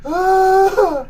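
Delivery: high, loud, wheezing laughs; pained laughing. Real, not acted. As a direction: gasp out the laughter. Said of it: "this hurts so bad".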